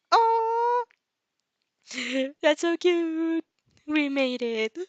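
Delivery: high, slow gush over something cute. Real, not acted. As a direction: squeal with delight, drawn out. Reader gushes over the story.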